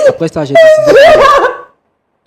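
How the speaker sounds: loud, high, slow screaming sob; wailing sob. Real, not acted. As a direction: she breaks down howling. [name] hurle en pleurs. (hurlement en sanglots, aigu et lent)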